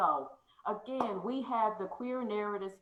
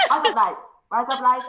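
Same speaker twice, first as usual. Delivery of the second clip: loud Aussie try; 5 semitones higher, 15 dB louder than usual. Shown as a demonstration. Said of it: "I don't know if it's Australian".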